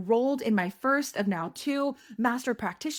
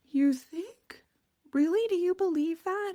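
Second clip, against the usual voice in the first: high-pitched mock-tearful questioning; retold weepy disbelief, pitched up; crying; 11 semitones higher than usual. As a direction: ask it in a small, high, imitated crying voice. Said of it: "make me stop crying".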